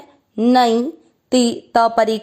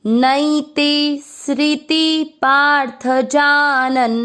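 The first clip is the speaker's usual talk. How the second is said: Shri in नैते स्मृति पार्थ जानन sung out slowly, a touch higher; slow verse recitation; 4 semitones higher, much slower than usual. Shown as a demonstration. Teacher reciting the Gita line slowly for students to follow. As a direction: chant each syllable of the verse slowly and evenly. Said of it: "वापस बोलेंगे".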